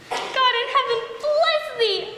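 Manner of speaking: high, slow joyful cry; joyful blessing. Acted. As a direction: bless him with delight. Nurse blessing with joy.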